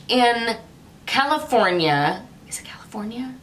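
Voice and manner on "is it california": whispered to herself, unsure of the name. Real, not acted. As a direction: quietly ask yourself if it's right. Unsure whispered self-question.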